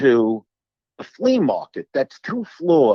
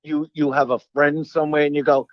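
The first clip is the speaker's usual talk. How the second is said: usual speaking voice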